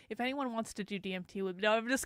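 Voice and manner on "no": usual speaking voice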